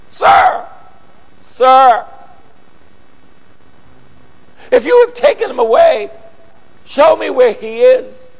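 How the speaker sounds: high, pleading woman's voice; pleading Mary. Acted. Mary begging the gardener, high and pleading. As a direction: beg him to show where he is.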